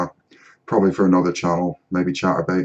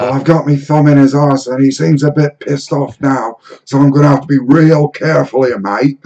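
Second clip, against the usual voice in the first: Steve Irwin; 6 semitones higher, 6 dB louder than usual. Retold or imitated. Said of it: "Steve Irwin".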